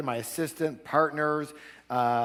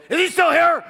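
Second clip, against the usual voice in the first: much higher, loud suspicious yell; angry shout; 12 dB louder than usual. Retold or imitated. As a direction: shout it through the door. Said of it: "he goes".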